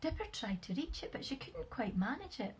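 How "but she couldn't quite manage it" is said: usual speaking voice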